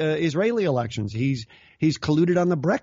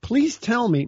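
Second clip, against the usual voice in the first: exasperated plea; 8 semitones higher than usual. Retold or imitated. Asking the accusers to explain, exasperated and higher. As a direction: beg to be told, half mocking. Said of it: "I go".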